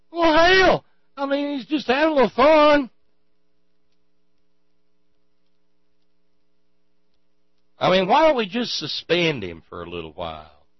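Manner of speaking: a high, loud, hammed-up Chicago accent on "Well, hell!"; mock a Chicago board member in a clumsy Chicago accent; bad Chicago accent. Mocking a police board member, in a bad Chicago accent. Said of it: "I don't do the Chicago accent very well".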